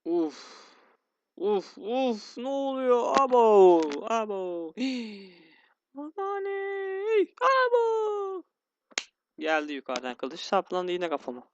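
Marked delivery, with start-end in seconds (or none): 0.0-5.4 panicky yelps. Real, not acted. Frustrated panicking at hard level. 6.0-8.4 high, slow, drawn-out mock wail of despair. Real, not acted. Wailing as character dies again.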